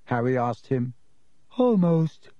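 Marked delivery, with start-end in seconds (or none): 1.6-2.3 mournful "almost", higher and louder; a ghostly lament with a falling wail; sad ghost. A narrator's reading. Ghost answers the boy's question about tasting food, sadly. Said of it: "said the ghost sadly".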